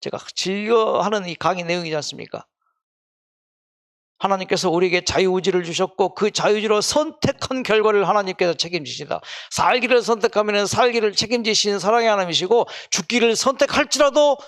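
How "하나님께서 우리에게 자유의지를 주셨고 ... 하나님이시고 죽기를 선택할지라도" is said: loud, high, driving sermon voice; fervent preaching. Acted. Preacher proclaiming God's love to the congregation, fervent. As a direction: preach it full force to the hall.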